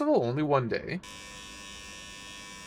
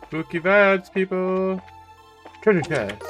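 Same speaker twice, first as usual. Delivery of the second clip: much higher, louder playful call; spooky vibes shout; 7 semitones higher, 7 dB louder, slower than usual. Pretend. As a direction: call it out to the crowd.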